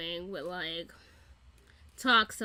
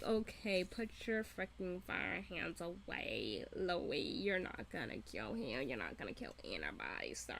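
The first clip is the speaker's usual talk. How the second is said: dismissive, soothing; 4 semitones lower, 8 dB quieter than usual. Retold or imitated. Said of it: "And I'm like".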